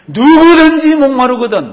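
shouting high and loud; Jesus shouting to the crowd, loud cry. Retold or imitated. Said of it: "막 큰 소리로 외칩니다".

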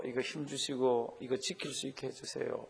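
hushed, low little prayer; soft prayer demo. Shown as a demonstration. Pastor demonstrating a quiet prayer while reading, hushed. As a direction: pray it under your breath. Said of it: "이렇게 기도하는 마음으로".

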